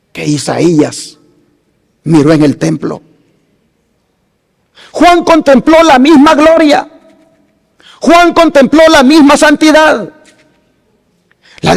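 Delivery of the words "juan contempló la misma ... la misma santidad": preaching climax. Acted. Preacher's climactic parallel lines.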